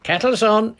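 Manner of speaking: loud, high call down through the trees; old man hollering to the men below; shout. Acted. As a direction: bellow it down the hill. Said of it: "he shouted in a much louder voice".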